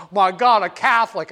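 put-on loud, scandalised old conservative; mock outraged conservative. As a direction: bellow it like a horrified bigot. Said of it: "you should have heard the conservative".

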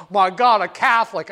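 loud, appalled cry of alarm; mock horror, loud; outraged conservative. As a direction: shout it like a scandalized old bigot. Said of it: "you should have heard the conservative".

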